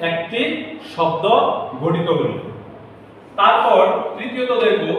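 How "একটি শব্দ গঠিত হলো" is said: ordinary talk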